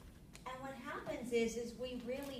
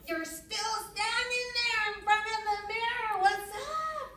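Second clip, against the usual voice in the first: high, loud exasperated yell; mock yelling; 10 semitones higher, 8 dB louder than usual. Wife yelling at her husband still at the mirror: high, loud, exasperated. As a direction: shout it at him, fed up, pitched way up. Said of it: "and I'm still yelling at him".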